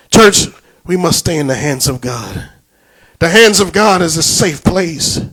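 ordinary talk, nothing marked

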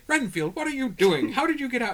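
high, loud alarmed outburst; alarmed shout. Retold or imitated. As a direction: cry out in shock at the intruder. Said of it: "And everyone's like".

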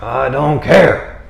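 slow, stubborn, dismissive; defiant Jonah. Retold or imitated. Jonah brushing off God, slow and stubborn. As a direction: drag it out like a sulking prophet. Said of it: "Jonah's a little tougher than most. He's like".

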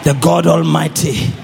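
low, loud, weighty declaration; solemn proclamation. Real, not acted. Preacher naming God to the congregation, solemn and loud. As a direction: proclaim it slowly and firmly.

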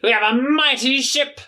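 high indignant pirate voice; offended pirate. Acted. Pirate correcting the party about boat, indignant. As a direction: insist it's a ship, proudly.

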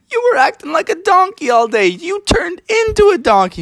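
very high giggly teasing; kids laughing teasing. Acted. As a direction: tease him through laughter.

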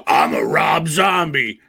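high, loud, shouted put-on rocker growl. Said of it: "What other wholesome [name] things".

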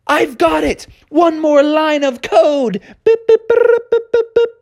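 high, eager cry then beeps; triumphant high exclaim; eureka shout. Acted. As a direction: burst out with the breakthrough. Techno Bear finds the fix.

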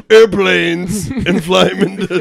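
loud, slow mock action-hero declaration; hammed-up movie tough guy building in volume; hammed-up heist announcement. Acting Dom announcing a heist.

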